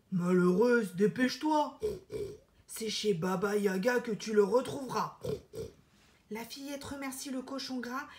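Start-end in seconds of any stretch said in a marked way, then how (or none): 0.1-5.2 low, louder pig voice; urgent pig warning; gruff warning pig. Acted. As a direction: warn her like a gruff pig. Pig warns Elena.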